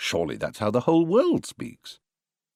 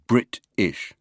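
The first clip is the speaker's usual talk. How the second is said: "Brit-ish" slowly split into two weighted halves; equal syllable stress. Shown as a demonstration. Lecturer showing a wrong way, "Brit-ish" evenly stressed in two pieces. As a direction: separate "Brit-ish", a push on each part. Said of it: "We never say".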